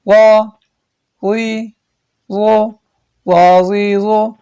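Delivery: slow, drawn-out chant of each letter and vowel; qaida recitation. Shown as a demonstration. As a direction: recite the drill, stretching every syllable.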